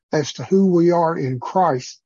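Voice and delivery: ordinary talk, nothing marked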